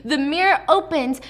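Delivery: plain reading voice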